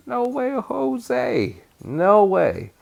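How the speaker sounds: very high, drawn-out mock refusal; playful refusal. Teacher rejects a lowercase m, playfully emphatic. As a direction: stretch it out high, a teasing no.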